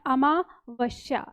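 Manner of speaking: Amavasya drawn out slowly, each syllable clear. Shown as a demonstration. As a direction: spell out Amavasya slowly for students. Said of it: "तो शब्द लिखेंगे अमावस्या".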